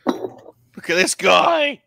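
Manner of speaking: slow, loud, excited exclaim; excited shout. Real, not acted. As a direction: show off the figure with delight. Excited at new figure.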